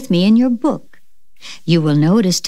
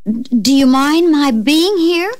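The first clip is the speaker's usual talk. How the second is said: nervous; 7 semitones higher, slower than usual. Acted. Nervous visitor speaks.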